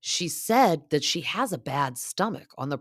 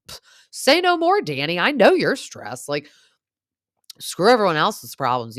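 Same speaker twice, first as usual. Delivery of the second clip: much higher and louder, mock-pitying; sarcastic sympathy; 6 semitones higher, 8 dB louder than usual. Teasing Danny about three kids under three, high sarcastic sympathy. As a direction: pile on fake understanding for the stressed dad. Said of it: "Pff, say no more, literally".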